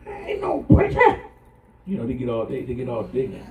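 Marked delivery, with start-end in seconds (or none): 0.1-1.2 put-on squeaky high child protest, loud; high-pitched child yelling no; defiant child. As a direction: voice a sulky kid shouting back in a high squeak. Child's defiant reply voiced.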